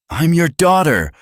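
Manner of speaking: high, loud, tearful outburst; crying protest. A narrator's reading. Daughter crying out to accusing father, high and loud. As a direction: wail it out as an anguished plea. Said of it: "She was crying now".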